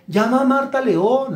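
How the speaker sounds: high, pious voice parroting an order from the Lord; mock divine order. Preacher mocking a flatterer who claims the Lord spoke, high pious voice. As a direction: sing out the holy instruction in a falsetto. Flatterer quoting what God told her.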